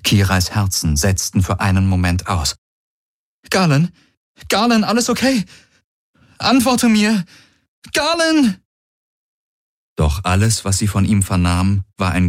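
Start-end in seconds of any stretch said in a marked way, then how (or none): 3.5-8.6 rising, ever higher calls of his name; panicked calling. A narrator's reading. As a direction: plead for an answer, growing frantic. Kira calls wounded Galen over comms.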